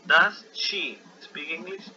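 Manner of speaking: Does she speak English? said very slowly; model question. Shown as a demonstration. Teacher reads the example to students, very slowly. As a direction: drag the question out for learners.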